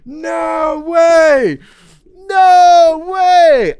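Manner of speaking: high, loud disbelieving shouts; real excited yelling; shouted no way. Real, not acted. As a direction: yell it in delighted disbelief. Reacting to gift.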